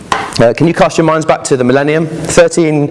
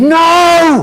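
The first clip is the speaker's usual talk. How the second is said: high, shrill-free screaming no, pitched way up; a little girl screaming no on the plane; child's scream; 3 dB louder than usual. A narrator's reading. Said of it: "she just screamed".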